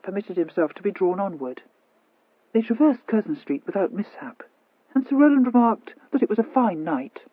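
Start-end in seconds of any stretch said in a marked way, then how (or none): none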